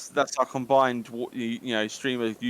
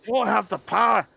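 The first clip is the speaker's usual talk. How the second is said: put-on a light Scottish accent, higher; a mock Scottish whine; 7 semitones higher, 4 dB louder than usual. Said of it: "I just made my own impression".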